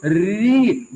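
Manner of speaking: slow री with a fast-vibrating tongue that does not touch the palate; slow demonstration of the trilled री; drawn-out vibrating ri. Shown as a demonstration. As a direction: stretch out री and let the tongue vibrate. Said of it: "vibration करती रहेगी बहुत तेज".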